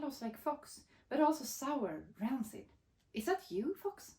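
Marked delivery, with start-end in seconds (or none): 3.1-4.1 nervous. Acted. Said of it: "Mole asked nervously".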